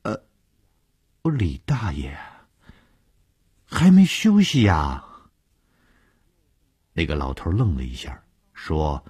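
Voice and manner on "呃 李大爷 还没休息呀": hesitant greeting rising to a high, loud question; halting, faltering politeness; slightly stammering. A narrator's reading. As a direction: stall on a filler, then push the question out high. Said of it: "有些口吃地问".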